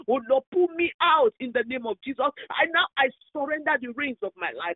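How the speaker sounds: loud pleading prayer, building; fervent prayer. Real, not acted. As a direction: cry out to God to be pulled out. Leads surrender prayer.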